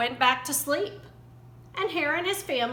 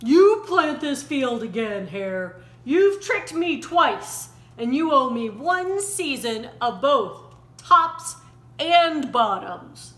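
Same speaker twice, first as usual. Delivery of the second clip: louder, slow, growled demand; slow, louder angry bear; growling; 6 dB louder than usual. A narrator's reading. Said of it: "Bear growled".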